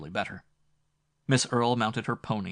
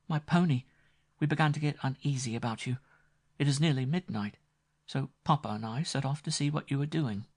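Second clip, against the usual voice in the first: put-on higher, lightly teasing girl's voice; playful young woman; 6 semitones higher than usual. A narrator's reading. Grace teasing the frightened Winmore on the stairs, playful high voice. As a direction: tease him gently, she's quite safe. Grace explains their coming.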